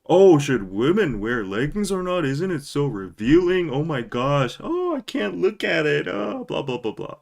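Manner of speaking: high, whiny mock complaint; hammed-up pearl-clutching; mocking fussy voice. Mocking men who pretend to object to leggings. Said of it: "there is no frickin' debate".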